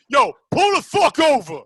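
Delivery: very loud, high TV-cop order; mock cop shout. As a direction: yell it like a hammed-up police stop. Acts out cop from imagined show.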